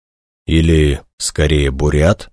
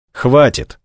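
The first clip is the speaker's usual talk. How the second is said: firm, higher-pitched cut-off; decisive; 7 semitones higher than usual. A narrator's reading. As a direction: put a resolute stop to it. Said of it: "решительно произнесла Наира". (решительно)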